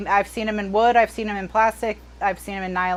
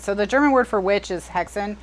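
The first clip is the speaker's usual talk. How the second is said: normal talking voice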